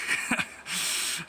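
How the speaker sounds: short unplanned laugh; real little laugh. Real, not acted. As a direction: break off into a brief laugh. Reader laughs at the story.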